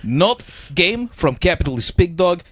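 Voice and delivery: lightly put-on Russian accent, broken English; a hint of Russian, played for laughs; mock Russian accent. Friend shows off his Russian accent for the group, lightly mock Russian accent. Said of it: "I think I do an okay Russian accent".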